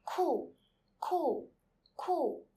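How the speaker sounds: each "Cool" as Chinese "ku", loud and high, falling fast; falling Mandarin tone. Shown as a demonstration. Teacher drilling students to repeat, falling tone on "Cool". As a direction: say "Cool" like "koo", separately, with steep drops. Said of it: "third Chinese word with the first tone".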